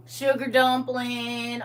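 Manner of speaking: slow, drawn-out Southern drawl; Southern endearment. Retold or imitated. Quoting Southern pet names, slow country drawl. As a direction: stretch out the pet name in a Southern accent. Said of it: "my brother sounds like that".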